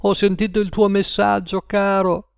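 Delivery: high-pitched, gushing reunion; emotional greeting. A narrator's reading. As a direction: pour it out to a loved one. Woman rushes to embrace husband. (voce acuta e commossa)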